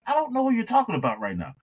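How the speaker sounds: high, quick, shrugging it off; mock clueless retort. [name] telling the coworker he has no idea who Chuck is, high and dismissive. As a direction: brush the question away, fast and high.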